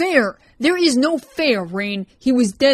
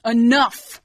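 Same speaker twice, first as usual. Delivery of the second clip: sharp shout; 5 semitones higher, 9 dB louder than usual. Acted. Ryan stops their argument.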